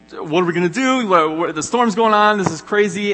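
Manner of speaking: frantic disciples in a storm, hammed up; panicked disciples. Disciples in the storm fretting to each other, mock panic. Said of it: "and they are kind of just like".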